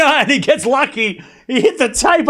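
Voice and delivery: ordinary delivery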